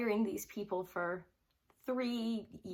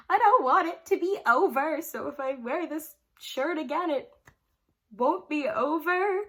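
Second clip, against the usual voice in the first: whiny pleading; 7 semitones higher, 8 dB louder than usual. Retold or imitated. Retold inner whining about concert.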